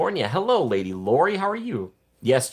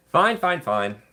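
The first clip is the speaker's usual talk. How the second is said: exasperated fine; 7 semitones higher, much slower than usual. Real, not acted. Louder second take of fine.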